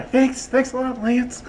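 high puppet voice; lightly squeaky character voice; Audrey. Acted. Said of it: "Here's my Audrey".

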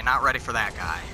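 ordinary talk, nothing marked

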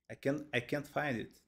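ordinary talk

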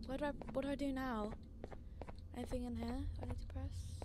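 ordinary talk, nothing marked

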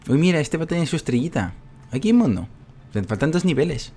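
high-pitched, charmed exclamation; delighted cooing. Real, not acted. Gamer spotting a player's little star, high delighted coo. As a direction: coo high over something cute.

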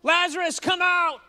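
high, loud call to the dead man; Jesus shouting into the tomb; shouted command. A narrator's reading. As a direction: call him out of the tomb with full force. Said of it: "Then Jesus shouted".

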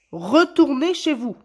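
cri plus haut, bien plus fort et lent sur «Retournez»; crié. A narrator's reading. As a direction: crie «Retournez chez vous», excédé. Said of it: "cria-t-il". (Wamba crie à ses invités de partir)

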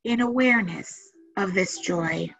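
measured meditation guide, long pauses between phrases; unhurried guided-meditation delivery with room to breathe. Acted. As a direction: lead listeners gently through it, phrase by phrase.

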